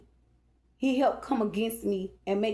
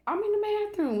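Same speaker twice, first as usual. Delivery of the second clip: light, much higher answer hiding tears; 11 semitones higher than usual. Retold or imitated. Answering the niece while hiding her crying, light and high. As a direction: keep it light so nobody hears the crying. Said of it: "you know, light".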